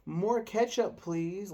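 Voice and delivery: put-on high, loud child's call; kid shouting. His oldest son calling the waitress, a high loud kid's shout. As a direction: shout it like an eager little boy.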